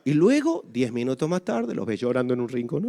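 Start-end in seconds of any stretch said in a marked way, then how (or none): none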